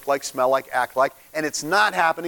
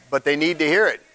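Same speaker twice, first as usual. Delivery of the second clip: normal talking voice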